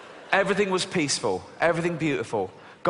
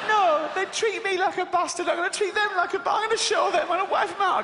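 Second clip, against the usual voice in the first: high, petulant tantrum voice; hissy fit; 13 semitones higher, faster than usual. Retold or imitated. [name]'s God throwing a high hissy fit. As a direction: stamp your foot like a sulking diva. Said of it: "having a hissy fit".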